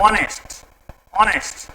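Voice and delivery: ऑनेस्ट twice, each starting on the vowel "o"; correct pronunciation demo. Shown as a demonstration. Teacher drilling students to remember it, ऑनेस्ट with no h sound. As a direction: repeat ऑनेस्ट with the h left silent. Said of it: "इसको हमेशा ध्यान रखें".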